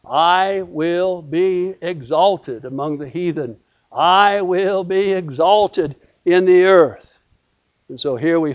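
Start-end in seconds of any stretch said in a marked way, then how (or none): 0.1-7.1 EXALTED slow and drawn out, each word weighted; solemn emphasis. Acted. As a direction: proclaim "I will be exalted" slowly and deliberately. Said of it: "I think, for emphasis".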